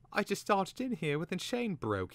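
high-pitched Twig voice; young Twig. A narrator's reading. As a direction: play Twig high and anxious to explain.

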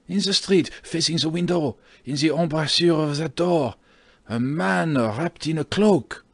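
high, agitated citizen raising the alarm; alarmed pointing-out. Acted. Landlord points out the lurking stranger.

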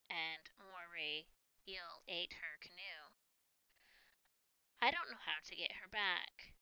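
put-on quiet, glum girl voice; softly sad spider girl. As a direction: speak softly, a bit defeated. Spider girl speaks sadly.

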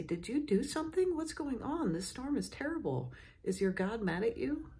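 high, frantic sailors fearing the storm; panicked sailors. Acted. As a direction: voice shoots up high in alarm.

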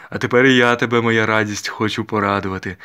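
put-on loud, expansive old gentleman; an old master's hearty proclamation. A narrator's reading. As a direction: announce a treat to a darling niece, loudly. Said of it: "сказав старий пан".

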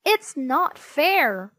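slow whiny wail. A narrator's reading. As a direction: drag out the complaint, whining. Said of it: "wailed Henry".

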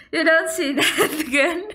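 talking through giggles; laughing. Real, not acted. Gamer after a scare, giggling through the words.

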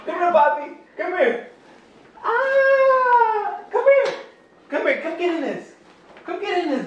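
sing-song coax the little one over. Real, not acted. Dad coaxing his baby into the rain cover, high playful call.

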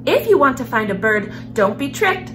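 plain reading voice

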